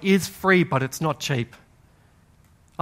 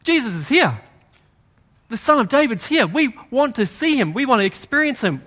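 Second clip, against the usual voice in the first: raised, higher desperate calling out; crying out; 7 semitones higher than usual. Acted. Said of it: "Jesus hears their cry".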